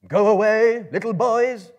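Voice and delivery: big loud high shout back; Gandalf shouting. A narrator's reading. Gandalf shouts down from his tree at the goblins, very loud. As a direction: bellow down at the goblins. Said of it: "shouted Gandalf in answer".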